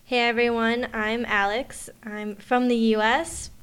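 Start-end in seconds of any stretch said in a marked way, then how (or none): none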